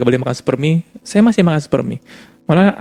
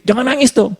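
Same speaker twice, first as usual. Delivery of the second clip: mock scolding; 11 semitones higher than usual. Husband scolds crying wife.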